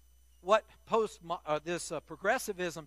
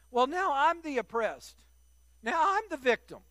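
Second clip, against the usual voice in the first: mock whiny victim voice, pitched up; 7 semitones higher than usual. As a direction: whine it like a self-pitying victim. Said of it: "I'm trying to do my whiny voice".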